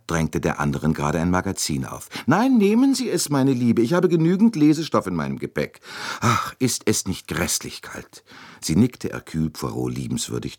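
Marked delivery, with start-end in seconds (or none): 2.3-8.2 chatty American lady. Acted. Mrs Hubbard pressing a magazine on the Swede, high and fussy. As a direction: gush over her like a busybody matron.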